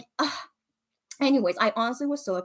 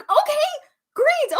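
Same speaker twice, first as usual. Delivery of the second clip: surprised; 4 dB louder, much slower than usual. Retold or imitated. Her surprised reply.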